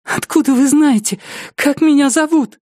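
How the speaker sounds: high-pitched, anxious female character; frightened woman. Acted. Captive woman asks how attacker knows her, high and fearful.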